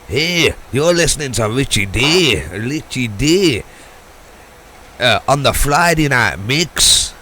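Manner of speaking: loud, slow hammed-up Scottish DJ voice; a bad Scottish jock accent, shouted like a radio DJ; rough Scottish radio jingle impression; mock Scottish accent. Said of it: "in a Scottish jock accent".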